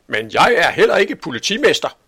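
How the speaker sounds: high, loud comeback; indignant retort. A narrator's reading. Angry butcher retorts to police chief. (højt, fortørnet svar)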